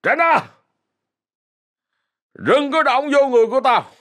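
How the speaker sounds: high, angry shout; high-pitched drunken outburst; drunken yell. Acted. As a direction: warn them off in a drunken temper. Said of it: "la lên trong cơn say".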